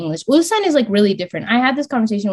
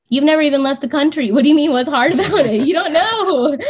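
exasperated retort; 6 semitones higher than usual. Retold or imitated. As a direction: snap back at someone who doesn't get it.

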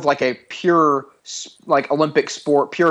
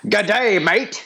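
quick mock English accent, light; 6 semitones higher, much slower than usual. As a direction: toss off a jokey English accent. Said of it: "in an English accent this time".

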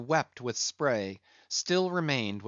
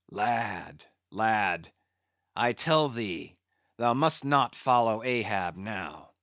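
brooding captain. Acted. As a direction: speak gently but gravely to the boy. Ahab speaks to Pip.

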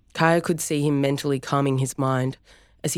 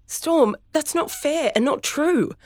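screaming; 13 semitones higher, slower than usual. Acted. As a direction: scream at him to stop. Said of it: "Kaya screamed".

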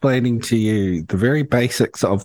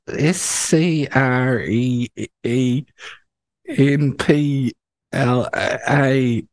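slow spelling; much slower than usual. Real, not acted. Spelling out a hard word.

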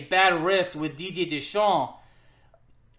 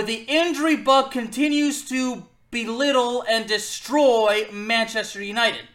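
normal talking voice

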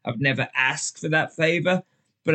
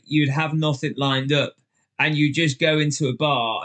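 usual speaking voice

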